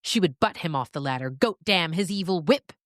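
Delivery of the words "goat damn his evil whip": plain reading voice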